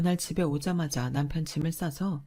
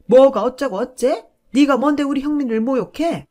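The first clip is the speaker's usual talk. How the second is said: furious shriek; 6 semitones higher, 10 dB louder than usual. Acted. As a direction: explode at the daughter-in-law. Mother-in-law enraged by retort. (아들을 모욕당한 시어머니, 격분한 고함)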